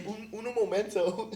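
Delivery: mocking a waiter's "uno momento". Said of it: "they're like".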